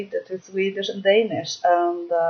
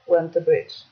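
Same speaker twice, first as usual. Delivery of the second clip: ordinary delivery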